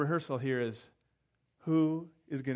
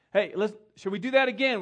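calling out; 7 semitones higher, 9 dB louder than usual. Retold or imitated. Calling group to redo rehearsal.

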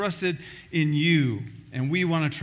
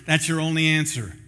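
ordinary talk, nothing marked

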